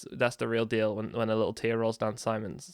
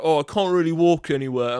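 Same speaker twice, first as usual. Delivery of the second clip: imitating; 4 semitones higher, 5 dB louder than usual. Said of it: "He's like".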